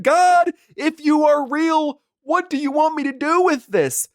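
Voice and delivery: theatrical desperate prayer; mock prayer to God. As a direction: beg heaven for answers, hammed up. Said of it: "I looked up and I said".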